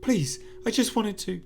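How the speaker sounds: Sarah's voice haunting Phil, shrill frantic plea; frantic pleading. Acted.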